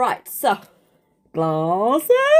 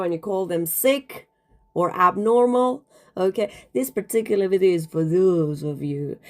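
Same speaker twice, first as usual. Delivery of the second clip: ordinary delivery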